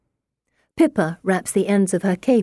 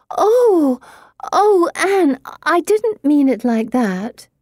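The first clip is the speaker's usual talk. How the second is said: much higher and louder, flustered; dismayed apology; 10 semitones higher, 4 dB louder, slower than usual. Acted. Pippa realizes she hurt Anne.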